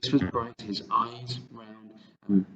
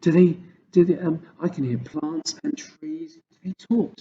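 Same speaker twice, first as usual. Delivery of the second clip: put-on lightly raised, curious little girl; young girl voice; 8 semitones higher, 8 dB louder than usual. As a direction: ask eagerly like a small girl. Sophie voiced by narrator.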